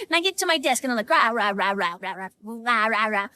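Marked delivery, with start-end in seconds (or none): 1.1-3.3 low mock grumbling in nonsense syllables; comic wordless griping; upset grumbling. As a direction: grumble to yourself, pitched well down. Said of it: "So I am all upset".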